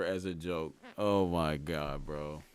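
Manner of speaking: ordinary delivery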